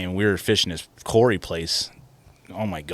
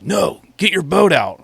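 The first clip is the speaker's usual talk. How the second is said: slow, higher mock old man; old man ordering; 9 semitones higher, 5 dB louder, slower than usual. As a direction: play the grumpy old man telling him off. Old man yelling at him.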